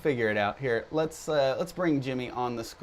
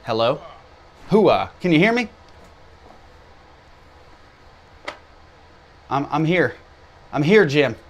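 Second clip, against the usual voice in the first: calling out; 2 semitones higher, 9 dB louder than usual. Real, not acted. Calling out over a bad connection.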